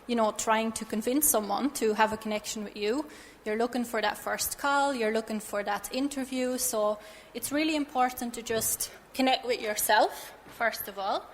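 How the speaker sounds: usual speaking voice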